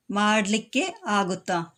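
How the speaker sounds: മാഡ്ലിക്ക് ആകുത്താ said slowly as two words with a gap; slow split form. Shown as a demonstration. Teacher showing students the split form മാഡ്ലിക്ക് ആകുത്താ, slow. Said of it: "ഇനിയും ഷോർട്ടായി പറയുകയാണെങ്കിൽ".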